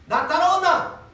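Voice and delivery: lightly voiced Jesus calling in broad Gyeongsang dialect. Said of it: "오늘 경상도 말로".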